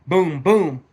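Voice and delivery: loud boom boom gunshot noises; imitated shotgun blasts. Picturing grabbing his twelve gauge, loud booming gunshot sounds. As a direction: fire off two loud booms.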